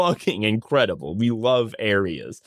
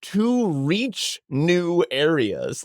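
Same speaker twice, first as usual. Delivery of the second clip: slow, drawn-out reading of the line; deadpan slow book quote; dramatic slow read; 5 semitones higher, much slower than usual. Retold or imitated. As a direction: savour each word of it. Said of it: "and then to just say".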